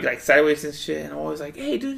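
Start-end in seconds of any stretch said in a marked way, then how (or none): 1.6-2.0 high, bright mock hello; mock greeting. Greeting the drunk neighbor with a high mock hey. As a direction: chirp a fake friendly hey.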